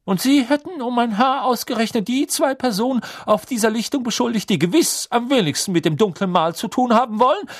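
high, loud barking man. A narrator's reading. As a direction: bark it at him hotly. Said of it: "bellte Mister Crouch".

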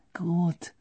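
secretive, urgent undertone; hushed warning. A narrator's reading. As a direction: warn quietly and quickly. Agreeing secretly while in hiding.

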